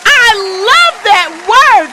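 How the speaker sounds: high, loud, slow delight; thrilled. Real, not acted. Host praising the guest's word, high and loud. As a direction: gush about the word, still buzzing.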